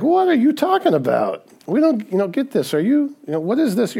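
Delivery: high-pitched, mock-bewildered questioning; baffled disciples. Disciples failing to understand Jesus' teaching, baffled. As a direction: ask it high, as if totally lost. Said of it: "They don't get it. They're like".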